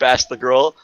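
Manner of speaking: plain reading voice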